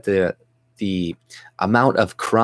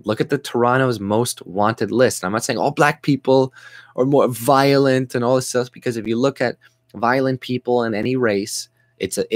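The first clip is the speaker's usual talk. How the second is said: normal talking voice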